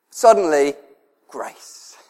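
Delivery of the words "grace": whisper. Acted. Whispered word in sermon.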